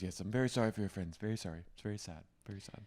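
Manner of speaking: soft, fading murmur; mock half-hearted apology. Acting out Vicky's half-hearted apology.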